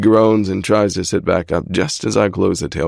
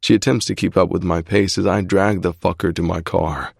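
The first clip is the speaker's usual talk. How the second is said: usual speaking voice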